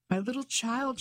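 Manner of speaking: high and joyful, calling across the garden; mother greeting her child, calling out high and joyful. A narrator's reading. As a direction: call to the child with open arms. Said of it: "she called".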